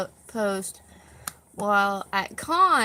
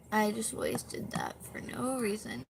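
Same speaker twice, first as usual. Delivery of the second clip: whispered self-mockery; whispered aside; 6 dB quieter than usual. Real, not acted. Streamer whispering a self-mocking aside to chat. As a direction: drop to a whisper for the joke.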